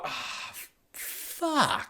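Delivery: whispered, deflated curse under the breath; dejected groan. Retold or imitated. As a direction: mutter it, crushed and disappointed. Groaning at seeing Adam Sandler.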